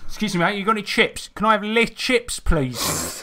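the clueless Brit ordering chips in France; British tourist. Retold or imitated. Friends mocking tourists, mock British tourist voice. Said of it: "like, say".

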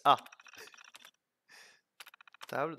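usual speaking voice

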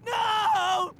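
sobbing scream for help; slow wailing plea; crying plea. Acted. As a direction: beg through panicked tears. Begging in terror.

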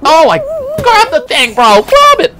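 high, loud, urgent shout; frustrated yell. Real, not acted. Streamer missing a grab in game, frustrated high yell.

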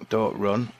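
usual speaking voice

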